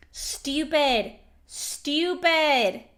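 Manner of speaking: ordinary talk, nothing marked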